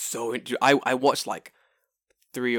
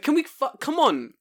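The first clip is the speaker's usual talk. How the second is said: exasperated; 6 dB louder than usual. Retold or imitated. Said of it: "I'm like".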